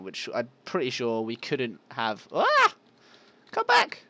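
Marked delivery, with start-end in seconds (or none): 2.3-2.7 sudden high, loud yelp; startled yelp. Real, not acted. Player caught off guard mid-sentence, high startled yelp. 3.5-4.0 high, loud call after it. Real, not acted. Player calling after something escaping, high loud shout.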